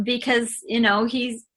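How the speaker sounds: ordinary delivery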